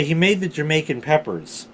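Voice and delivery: ordinary talk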